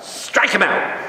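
high, rasped mock yell; hammed-up cry from the stands; imitated intensified heckling. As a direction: jeer the batter like a worked-up mom. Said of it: "my mother intensified when he got up".

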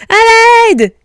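loud, panicked piglet scream; high frantic shout; cry for help. A narrator's reading. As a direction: yell for rescue in alarm. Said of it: "crièrent-ils".

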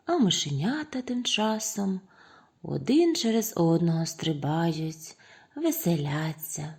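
ordinary delivery, nothing marked

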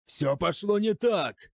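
slow, high, loud roar of dismay. A narrator's reading. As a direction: bellow it in despair. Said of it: "взревел Грамматикус". (рёв отчаяния)

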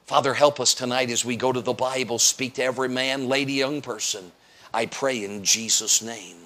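prayer. Real, not acted. Short prayer.

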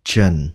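"chần" low and falling, not "chành"; second syllable demo. Shown as a demonstration. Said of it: "Không phải là chành mà là".

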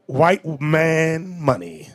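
very slow, deliberate punchline; slow and weighty; drawn-out answer. Real, not acted. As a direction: stretch out each word. Answers own question pointedly.

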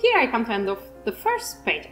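ordinary delivery

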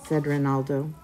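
ordinary delivery

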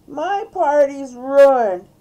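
loud, high, drawn-out whine; moaning. A narrator's reading. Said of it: "moaned D W".

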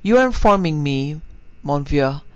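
with a smile. Acted. Little Frenchman teasing Ned about the drink, smiling. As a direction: let a knowing smile color the reply. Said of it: "answered with a smile".

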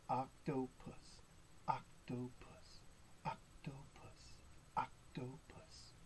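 slow, soft OC-TO-PUS in separate beats; hushed syllables. Pretend. Reader prompts children to say octopus, slow hushed chant. Repeat-after-me word for octopus.

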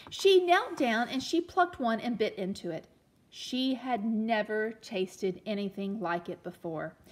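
put-on storytelling read-aloud for children; gentle read-aloud storyteller; story narration. A narrator's reading. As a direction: read it like a picture book to a class.

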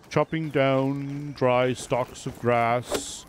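slow, hushed wildlife narrator; nature documentary. Pretend. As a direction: narrate like a nature show host.

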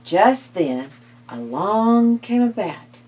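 ordinary delivery, nothing marked

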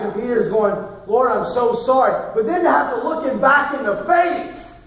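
high, loud, impassioned preaching; preaching at full force. Acted. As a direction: drive the message home with full voice.

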